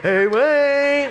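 high, loud sing-out through a door; pestering late-night caller; Mike. Retold or imitated. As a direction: call out with a long, drawn-out last word. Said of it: "I hear this voice".

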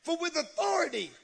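high-pitched, astonished questioning; amazed onlookers. Retold or imitated. Amazed crowd marvels at the new teaching, high astonishment. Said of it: "they say".

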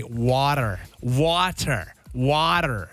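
"water" said the American way, as "wah-der"; demonstrated American "water" with a flapped t; American accent. Shown as a demonstration. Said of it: "I'll change my accent".

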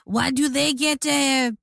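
put-on high, slow, hammy French accent; bad French accent, drawn out. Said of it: "That's my terrible".

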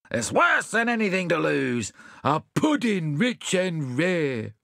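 high, slow mournful lament; lamenting character. Acted. Sad friend mourning the stolen pudding.

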